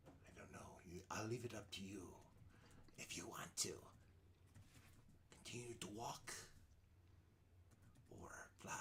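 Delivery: raspy hag whisper; old hag. Acted. Hag offers choice.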